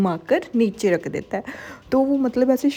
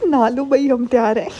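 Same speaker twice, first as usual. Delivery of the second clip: laughing tease; 3 semitones higher, 6 dB louder than usual. Real, not acted. Teasing the couple on screen, loud and laughing.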